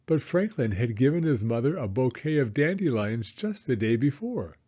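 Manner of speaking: plain reading voice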